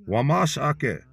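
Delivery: usual speaking voice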